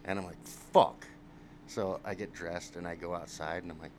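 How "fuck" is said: a sudden, much louder shocked "Fuck."; loud curse. Retold or imitated. Waking in a strange trailer, a loud dismayed curse. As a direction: blurt it out, dismayed.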